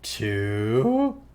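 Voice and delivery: slow clown countdown with a rising swoop; Pennywise. Retold or imitated. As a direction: count teasingly, pausing long between words. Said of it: "He's like".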